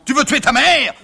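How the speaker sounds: high, loud indignant father; alarmed outraged shout. Acted. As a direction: burst in yelling, aghast. Father bursts in on mock murder.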